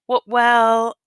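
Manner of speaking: a flustered cowboy stammering; stammered. A narrator's reading. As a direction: trip over the first word, flustered. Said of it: "Slim stammered".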